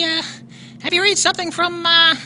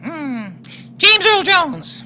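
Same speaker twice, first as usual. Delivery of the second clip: ordinary talk, nothing marked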